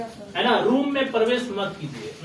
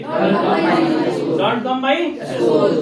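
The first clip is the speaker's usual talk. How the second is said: slow model sentence; much slower than usual. Shown as a demonstration. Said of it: "teacher models sentence".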